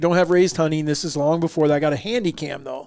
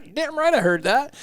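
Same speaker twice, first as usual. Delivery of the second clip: imitated dad; 9 semitones higher than usual. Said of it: "He's like".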